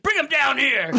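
high, comic devil demanding; complaining devil. Acted. Devil demanding a president be sent to hell, indignant. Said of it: "it'd be like the devil".

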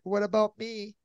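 lightly voiced wounded child; hurt little boy. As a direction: whine it like an ignored ten-year-old. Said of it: "like a ten-year-old boy".

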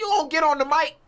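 high, loud call across room. Real, not acted. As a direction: call him to the mic. Calling to guest.

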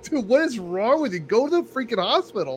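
high, exasperated pleading; can't believe what he's hearing; exasperated friend. Retold or imitated. Friend urging him to go to hospital, high and exasperated.

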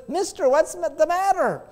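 put-on high child's voice; mild high-pitched little boy asking. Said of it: "a little boy comes up".

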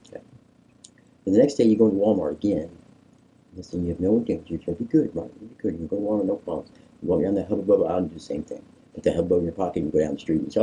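ordinary delivery

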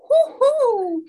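high, loud teasing whoop; a playful woo-hoo brushing them off; mock whoop. Teasing listeners who want answers, mock whoop.

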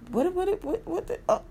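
high, stammering disbelief; stunned disbelief. Real, not acted. Amazed at Tyler Perry owning a production, squeaking in disbelief. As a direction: lose the words in amazement.

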